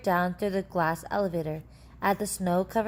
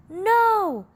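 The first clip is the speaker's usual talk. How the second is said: a high, loud shouted no; 14 semitones higher, 8 dB louder than usual. A narrator's reading. Charlie shouts at Mister Wonka to stop, high and loud. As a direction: yell it high in panic. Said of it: "shouted Charlie".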